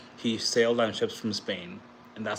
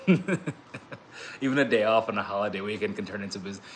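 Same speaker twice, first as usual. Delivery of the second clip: laughing. Real, not acted. Laughing about crypto talk at party.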